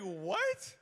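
loud, high, disbelieving What; shocked what. Retold or imitated. Pastor stunned by a question at the counter, loud and high. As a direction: blurt it out, caught off guard. Asked about his sermon topic.

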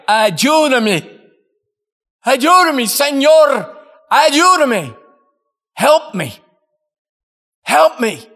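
high, loud pleading cries; desperate cry for help. Retold or imitated. Prisoner crying out to the preacher for help, desperate loud plea. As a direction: call out for rescue from behind bars. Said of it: "a man's voice cry".

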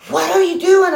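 high-pitched imitation of an alarmed man calling out; mock yell. Older man to woman pumping gas, imitated yell. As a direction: call it out high, as if across a lot. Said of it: "yelled across the parking lot".

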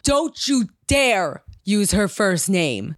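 slow, emphatic mock scolding; mild mock yell, drawn out; retold scream. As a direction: imitate an outraged teacher, stretching the words out. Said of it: "She screamed at me".

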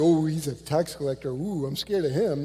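sarcastic mock fear. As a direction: scoff at him as if pretending to be scared. Soldiers mocking a tax collector.

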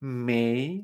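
"Mei" rising from low; drawn-out rising "Mei"; second tone. Shown as a demonstration. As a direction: lift "Mei" like a question.

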